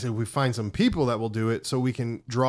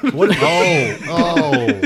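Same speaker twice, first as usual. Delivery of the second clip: laughing; 5 semitones higher, 8 dB louder, much slower than usual. Real, not acted. Laughing at glow sticks.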